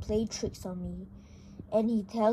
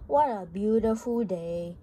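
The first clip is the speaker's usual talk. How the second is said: usual speaking voice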